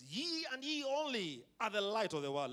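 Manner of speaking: leading the church with "ye" and "only" stressed. Shown as a demonstration. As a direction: proclaim it for the congregation to repeat. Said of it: "Verse fourteen would go like this".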